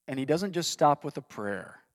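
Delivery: ordinary talk, nothing marked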